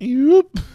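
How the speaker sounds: high, loud comic yelp; mock yelp. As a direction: squeak out a startled yoop. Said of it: "I just go from".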